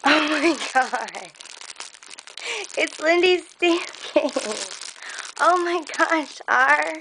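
high, drawn-out amazement; awestruck delight. Real, not acted. Stunned by the surprise gift.

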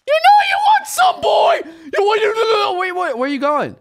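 very high, loud squeaky cartoon taunt; taunting bait voice. Bait player luring the giant worm, squeaky high taunting. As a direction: lure the worm like cheeky bait.

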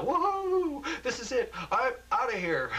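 very high, loud cheering; gleeful shout; excited whoop. A narrator's reading. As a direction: whoop like a kid set free. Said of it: "Ted, excited".

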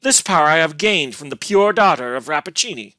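loud, high bitter proclamation; wrathful cry, starting high; scowling. A narrator's reading. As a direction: declare it with angry scorn. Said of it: "scowling upon her".

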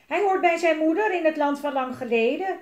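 high, loud indignant protest; yelling. A narrator's reading. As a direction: shout back in an argument. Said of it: "gilde Elise".

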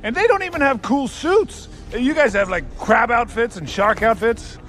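high-pitched, worked-up tirade; exasperated rant. Acted. Warlord berates generals over losing to ninjas, exasperated rant. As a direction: rant at them, voice pitched right up.

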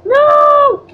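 loud high desperate no; shouted no. Real, not acted. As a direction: yell it at full force. Spice hits, yells.

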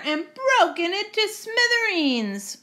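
high little-bear complaint; Baby Bear. Acted. Baby Bear finding his chair broken, high upset voice. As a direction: wail it up high like an upset cub. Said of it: "said Baby Bear".

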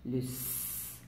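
S drawn out in a soft, voiceless hiss; whisper-hiss the S like a snake. Shown as a demonstration. Teacher to pupils, hissing the S sound. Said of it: "quel est le son".

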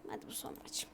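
unvoiced whisper, nearly silent. Real, not acted. Gamer promising himself it's his last match, whispered. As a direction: say it under your breath like a secret promise.

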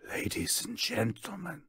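high, hushed stage whisper to the audience; theatrical, breathy whisper; showman's whisper. A narrator's reading. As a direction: whisper it like a ringmaster drawing the crowd in. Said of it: "he whispers".

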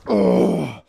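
loud, high strained grunt; explosive grunt of effort, reenacted; loud acted grunt. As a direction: heave out a big acted groan. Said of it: "this is what I did".

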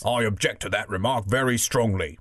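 lightly voiced bulldog; a hint of an objecting bulldog. Bulldog objecting to the elephant's nose remark, lightly voiced bulldog. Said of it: "said the bulldog".

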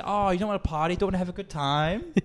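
high imitated come-on; coaxing woman. Prostitute to teller offering a good time, high coaxing imitation. Mimics the woman propositioning him.

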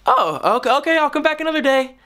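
high sheepish little voice; meek mock politeness. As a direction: play the defeated polite customer. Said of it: "And you're like".

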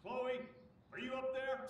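distant call from downstairs; calling up to a window. Acted. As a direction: call up from below, far off.

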